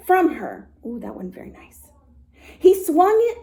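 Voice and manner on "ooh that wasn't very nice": soft aside. A narrator's reading. Aside about Fritz.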